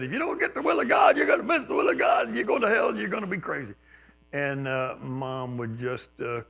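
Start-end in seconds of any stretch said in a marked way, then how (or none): 0.0-3.7 mock hellfire preacher, much higher and louder; preacher shouting. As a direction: shout it like a fiery preacher scaring a kid. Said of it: "A preacher said".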